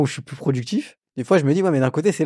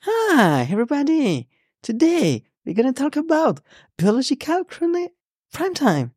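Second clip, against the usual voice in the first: very high, showy American TV host; présentateur télé américain; 13 semitones higher than usual. Pretend. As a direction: open the show like a TV host. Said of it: "on dirait que tu présentes une émission". (voix aiguë de présentateur télé américain)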